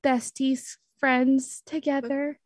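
sheepish, searching for the words; awkward excuse. Acted. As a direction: stammer your way through a tentative plea. Stammers excuse to jealous friend.

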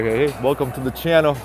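usual speaking voice

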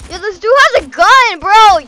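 loud, high-pitched excited warning; alarmed. Real, not acted. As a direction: shout a startled heads-up to a friend. Alarm at an armed player.

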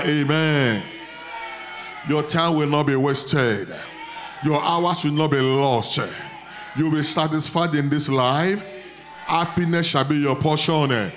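loud, ringing prayer over the crowd; preaching at full force. Acted. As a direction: proclaim it like a revival preacher.

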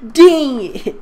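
high, loud mock yelp; mock frustrated cry. Girl fumbling at the lock, high loud mock yelp. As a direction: yelp it like a cartoon teen. Repeats the curse louder.

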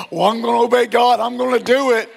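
grudging. Retold or imitated. Mocking grudging obedience.

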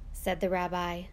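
ordinary delivery, nothing marked

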